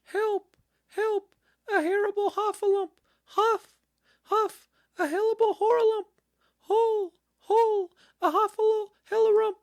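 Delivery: very high, loud, fast garbled squealing; panicked Piglet crying out. A narrator's reading. Piglet scampering off as hard as he could, squealing in panic. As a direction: tumble over the words in squeaky terror. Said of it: "still crying out".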